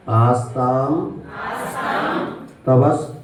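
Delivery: each word set apart, slow and clear; careful dictation of verse; slow word-by-word recitation. Shown as a demonstration. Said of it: "teacher spells verse word by word".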